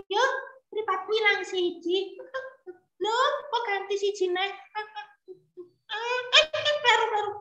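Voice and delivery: put-on high small child's voice, waking up. Storyteller as young Ismail waking at dawn, high child voice. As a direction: play a little boy just woken.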